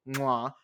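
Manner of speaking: smacked kiss sound of approval; quick verbal kiss of praise; chef's kiss mwah. Real, not acted. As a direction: blow an appreciative kiss, mwah. Chef's kiss praise of her allies.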